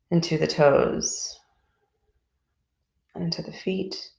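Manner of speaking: calm, even voice with long pauses between phrases; guided meditation. Real, not acted. Teacher leading a group body scan from toes upward, calm guided meditation.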